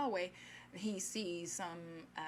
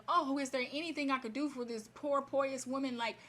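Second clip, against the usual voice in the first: higher, imploring mock plea; acted tearful begging without real sobs; retold pleading; 6 semitones higher than usual. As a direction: plead on someone's behalf, imitating desperation. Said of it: "he starts pleading with God ... he's crying".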